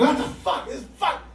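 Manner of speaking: high sputtered outburst; spray irritation at nobody in particular; half-voiced, high-pitched grumbling rage; mock anger. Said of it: "my anger is just a spray and pray".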